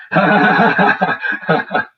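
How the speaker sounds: loud high-pitched cackle; high mocking cackle. Real, not acted. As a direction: burst into a shrill mocking laugh. Mocking someone's kid.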